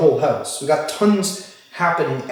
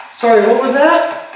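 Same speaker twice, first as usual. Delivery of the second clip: calling out; 8 semitones higher, 7 dB louder than usual. Real, not acted. Calling out to unseen voice.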